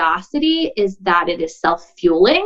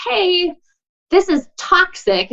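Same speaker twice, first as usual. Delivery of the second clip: higher, slow-drawn call of warning; mock alert; 10 semitones higher, slower than usual. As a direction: call it out high and stretched, as if alerting a crowd. Acting out someone calling out a problem.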